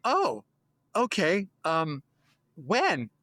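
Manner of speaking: high, startled "Oh", then a fumbling "when?"; shocked then stammering; flustered surprise. Retold or imitated. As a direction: thrown off balance, scrambling for words. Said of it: "You go".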